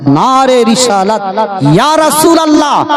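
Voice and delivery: high, ringing call to the crowd; rallying slogan. Acted. Preacher leading the congregation in the slogan, high rallying cry.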